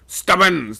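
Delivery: loud call up the mast. A narrator's reading. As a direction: hail him from below. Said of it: "sung out".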